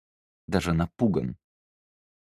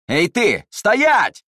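shout; 14 semitones higher, 10 dB louder, much slower than usual. Acted. Guards shout at fleeing girl.

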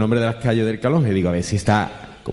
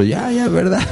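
high, mock-agreeing voice; mock agreeing cop; 9 semitones higher than usual. Cop humouring the satanist, high mock agreement. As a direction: humour him with a high sarcastic yes.

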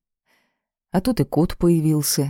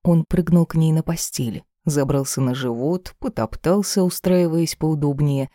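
ordinary delivery

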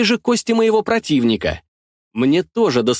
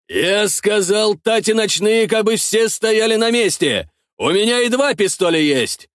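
menacing voice; 8 semitones higher than usual. A narrator's reading. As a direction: bellow a warning at the thieves. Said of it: "услышал я грозный голос". (грозный голос смотрителя)

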